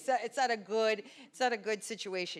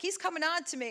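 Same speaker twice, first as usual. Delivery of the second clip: mock complaint; 9 semitones higher, 4 dB louder, faster than usual. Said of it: "And they're like".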